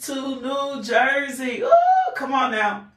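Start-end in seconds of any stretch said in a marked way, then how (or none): 1.6-2.1 a very high, loud laughing whoop; excited ooh. Real, not acted. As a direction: shriek with laughing joy at the viewers. Host greets viewers from New Jersey.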